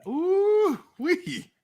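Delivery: high delighted ooh-wee; surprised whoop. Real, not acted. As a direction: let out a high impressed whoop. Reacting to a bold take.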